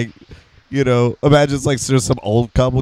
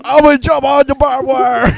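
put-on high, louder laughing kid voice; pitched-up parody with a laugh; goofy kid voice; 12 semitones higher, 5 dB louder than usual. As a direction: announce it like a dopey daredevil.

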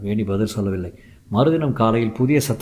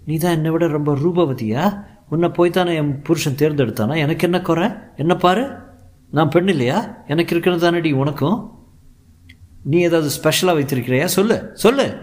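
threatening; 7 semitones higher than usual. Acted. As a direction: menace her, voice raised and high. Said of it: "என்று அவளை பயமுறுத்தினாள்".

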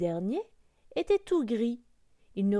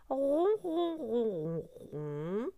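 cat purring; 3 semitones higher, much slower than usual. A narrator's reading. Cat shows the duckling how it purrs, purring. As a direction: purr it out, pitch gliding down. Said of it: "je ronronne".

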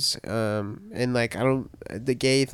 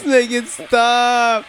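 laughing, high and slow; cracking up while pleading; 10 semitones higher, 4 dB louder, much slower than usual. Real, not acted. Laughing at the song Siri played.

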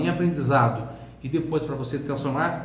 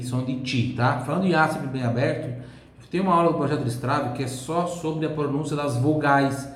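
ordinary talk, nothing marked

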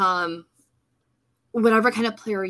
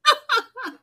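kind gentle laugh; 3 dB louder than usual. Real, not acted. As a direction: laugh warmly, not mocking. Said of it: "I'm not laughing at you".

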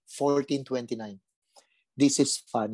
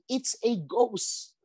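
mock frightened shout; 8 semitones higher, much slower than usual.

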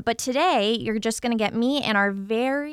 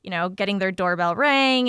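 usual speaking voice

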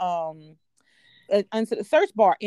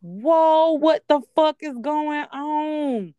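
higher, louder, slow shocked whoa; retold stunned outburst; 6 semitones higher, 4 dB louder, much slower than usual. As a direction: stretch it out in disbelief. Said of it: "I'm like".

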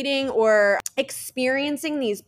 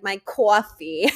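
slow New Jersey "My coffee", drawn-out vowels; Jersey accent; slower than usual. Retold or imitated. Host mocking her own New Jersey accent, slow. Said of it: "sound like a total idiot from Jersey".

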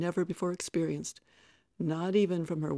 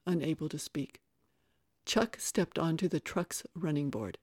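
ordinary delivery, nothing marked

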